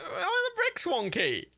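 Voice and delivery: very high wail of bafflement; distressed wail. Real, not acted. Gamer baffled by the bricks, distressed wail. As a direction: whine it out high in dismay. Bricks still going wrong.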